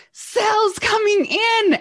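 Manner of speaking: hammed-up husband shouting the news; excited shout. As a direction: call out the big news like the husband did. Husband runs back, excited news.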